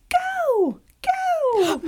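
very high, drawn-out little squeal; high-pitched cheer. Retold or imitated. Daughter telling parent how to cheer at soccer, high-pitched. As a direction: imitate a dainty falsetto cheer. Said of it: "make my voice a little higher pitch".

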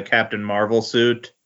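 normal talking voice

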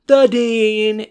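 high, loud chorus answer; mock chorus. The class answering Eric's question together, high and loud. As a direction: shout the answer like a whole class.

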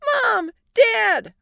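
put-on high, loud screaming little kid; child's scream. Small child screaming for mom and dad in alarm. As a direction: scream in alarm like a four-year-old. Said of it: "our four-year-old screamed".

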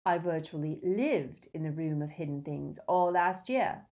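ordinary delivery, nothing marked

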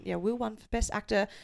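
usual speaking voice

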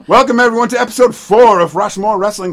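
booming show host welcome; announcer. Acted.

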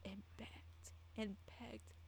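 slow, whispered repetition for sorrow; storyteller fading into a whisper; hushed. Real, not acted. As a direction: let it sink to a slow whisper. Hushed telling of victim begging.

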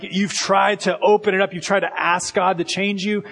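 ordinary talk, nothing marked

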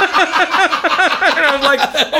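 high, laughing, stretched out; laughing hard. Real, not acted. Father laughing that his son swore in the car. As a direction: crack up while telling.

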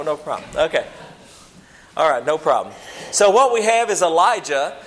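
ordinary talk, nothing marked

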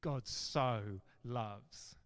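"God so loves" said very slowly, "so" drawn out; slow each word, lean on "so"; emphatic so. Shown as a demonstration. Preacher repeating the phrase to show its intensity, slow and emphatic. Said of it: "emphatic nature to this".

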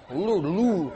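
Lou- Lou- restarted, laughing at himself; stuck on the French sounds; fumbling French attempt. Shown as a demonstration. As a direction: give up mid-word on the French. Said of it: "who can pronounce it?".

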